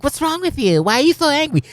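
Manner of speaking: high sneering imitation of youngsters; mocking young scold. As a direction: parody a snotty kid nagging. Mocking young people scolding old.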